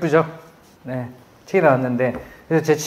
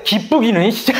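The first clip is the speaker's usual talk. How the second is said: sarcastic; 7 semitones higher, 3 dB louder than usual. Retold or imitated. Annoyed reply to cheerful coach.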